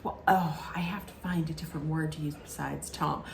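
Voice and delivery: whispered aside to herself; self-correcting whisper. Retold or imitated. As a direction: hush it, catching a slip. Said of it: "stops herself and says".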